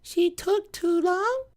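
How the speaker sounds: put-on timid kid guess. Kid answering uncle's quiz.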